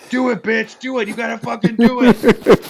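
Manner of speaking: high, loud egging-on yell imitating Gerard; imitating a coworker's loud, high dare; mock egging on. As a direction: egg him on like a rowdy coworker hyping a dare. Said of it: "he started being like".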